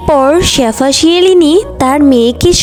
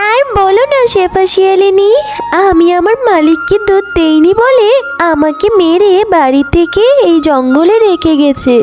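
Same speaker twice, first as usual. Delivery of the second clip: higher-pitched character voice for a wronged cow; plaintive cow; 5 semitones higher than usual. Acted. Cow telling the fox she was beaten and abandoned, plaintive. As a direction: pitch it up, telling a tale of woe. Cow figure tells her woes.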